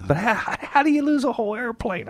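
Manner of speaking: high, baffled disbelief; incredulous. Real, not acted. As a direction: ask it as if you truly can't believe it. Host repeats the baffled question.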